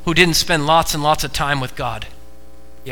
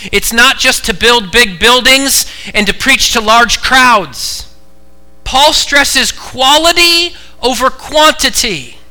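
loud, high preacher's emphasis; full-force preaching; 9 semitones higher, 7 dB louder, slower than usual. Real, not acted. Preacher correcting the congregation's idea of success, full-force preaching. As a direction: drive it home from the pulpit.

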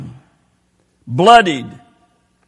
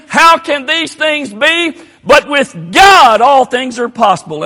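louder and higher, slow impassioned plea; preaching climax; 7 semitones higher, 6 dB louder than usual. Real, not acted. Preacher calls the congregation to trust, louder and slow. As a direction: build the prayer up, slow and ringing.

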